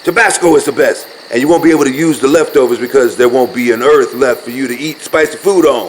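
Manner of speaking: loud, insistent bickering; heated argument. Acted. Arguing with a friend over hot sauce, loud and heated. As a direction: argue your point hard and loud.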